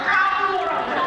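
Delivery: high, loud outburst, falling at the end; imitated shout. Conversation partner leaps up at the preacher, imitated loud shout. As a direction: mimic a sudden yell from someone mid-chat. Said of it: "Lawan bicara berdiri berkata".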